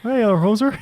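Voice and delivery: playful Canadian accent, hammed up; mock Canadian hoser.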